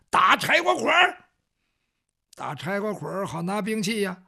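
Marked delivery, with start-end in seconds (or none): 0.1-1.2 high, loud battle call; shouted command. Acted. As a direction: call it out hard to a companion. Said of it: "韩章就喊". (高声大喊)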